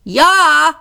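high, loud answering call; calling back. A narrator's reading. The four calling back to police, shouted. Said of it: "riefen sie zurück".

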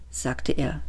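usual speaking voice

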